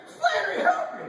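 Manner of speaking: put-on high comic Irish accent. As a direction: jump back into a hammy Irish lilt. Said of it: "jumping back into Irish".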